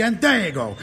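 ordinary talk

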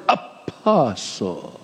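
apostle drawn out very slowly and softly, falling; mock-solemn apostle, stretched out; emphasis on apostle. Shown as a demonstration. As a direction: lean long and slow into apostle. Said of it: "put emphasis on that word".

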